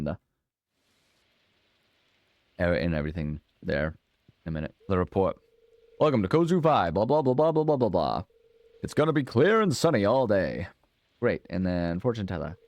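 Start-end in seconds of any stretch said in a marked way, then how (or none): 6.0-10.7 TV announcer voice. Pretend. Reading TV weather report aloud.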